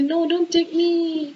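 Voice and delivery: high, drawn-out pretend wail; hammed-up plea of a clinging flower; mock wailing. Teacher imitates a flower dropping from the tree, mock wailing. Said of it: "weeping and wailing and grasping and crying".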